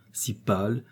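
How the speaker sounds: plain reading voice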